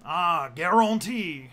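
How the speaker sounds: loud, drawn-out Cajun exclamation; hammed-up Cajun attempt; Cajun accent. Shown as a demonstration. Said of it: "work on a Cajun accent".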